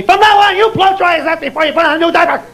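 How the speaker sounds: high, nagging scolding order; mock reprimand. Wife reprimanding husband over diaper rash, mock scolding. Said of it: "So she reprimands me".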